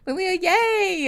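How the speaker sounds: high, slow happy cheer; excited. Real, not acted. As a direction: cheer her name out. Greeting a favourite viewer.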